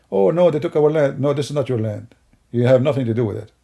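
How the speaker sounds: normal talking voice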